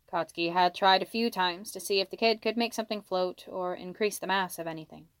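plain reading voice

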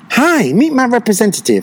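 high-pitched mock social hello; put-on cheery greeting. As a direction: greet like a newcomer putting up a front. Acting out guarded newcomers' greeting.

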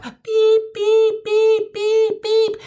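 high electronic backing-up beeps; reversing beeper. Retold or imitated. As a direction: beep like a truck backing up. Imitating cart reversing beeper.

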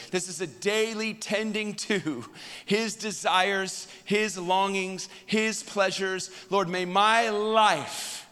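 high, loud, slow preaching cadence; preaching at full force. Acted. Pastor preaching to his congregation, loud and slow. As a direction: pour it out like a revival sermon, slow and ringing.

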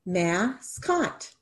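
soft, slow, split into mas and cot; syllables tapped out. Shown as a demonstration. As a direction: tap out each syllable of Mascot. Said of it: "How many syllables?".

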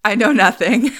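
loud, hammed-up punchline; animated catchphrase. Ending the story on the woman's catchphrase, playfully animated. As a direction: land the borrowed line as a loud joke. Closing joke in the borrowed voice.

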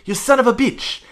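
high, loud Rey impression; mock-shout it like Rey; imitating Rey. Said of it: "He's like".

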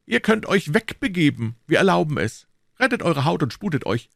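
commanding officer, pitched high; Jussac. Acted. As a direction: dismiss the youngster in a high, imperious voice.

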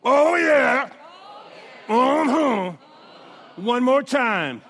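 loud laughing call-out; shout. Real, not acted. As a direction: whoop it up. Call-out during camp chant.

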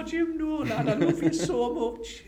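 high, slow, laughing lovesick plea; hammed-up high slow besotted wail; impression of himself as fan. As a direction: beg him like a child to a dad. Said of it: "Jack's got this impression of me".

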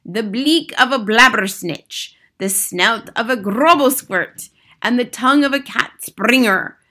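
lightly voiced commanding witch; theatrical witch giving orders; imperious head witch. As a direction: dictate a wicked recipe with emphatic relish. Grand High Witch dictates recipe.

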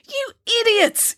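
high-pitched, drawn-out yell; slow, very high outburst of anger; angry yell. A narrator's reading. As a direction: yell in exasperation, stretching the words. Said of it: "yelled the investigator".

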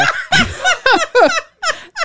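high, squealing imitated laugh; friend's laugh. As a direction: cackle high like your friend. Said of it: "this is what you hear".

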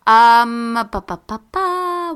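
normal talking voice

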